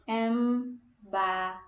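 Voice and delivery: "Embarrassed." said slowly, every syllable clear; slow model word. Shown as a demonstration. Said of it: "So as it's pronounced".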